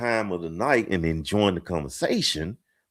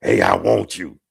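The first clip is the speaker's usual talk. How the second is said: low-pitched, blunt pick-up line; mock seduction, pitched well down; creepy come-on; 9 semitones lower than usual. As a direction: drop it low, like a creepy proposition. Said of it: "That's creepy".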